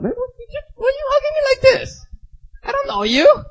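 high-pitched mock-indignant woman; indignant objection. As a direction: protest an unwanted hug in falsetto. Uncomfortable sisters object to hugs.

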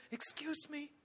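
tiny high, soft, halting voice; mock-feeble begging; timid Elijah. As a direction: squeak out a meek request, word by word. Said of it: "all he could muster the strength to ask".

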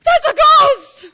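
high, loud, slow scared cry; terrified shout. Retold or imitated. Disciples spot a figure on the water, high frightened shout. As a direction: shriek it like you've seen a ghost. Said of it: "no wonder they're freaking out".

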